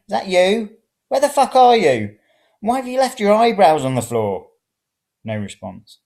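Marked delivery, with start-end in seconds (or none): 0.1-4.5 loud, high shouting up the stairs; shouting, alarmed. Acted. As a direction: yell it out, rattled and cross. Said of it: "I shouted again".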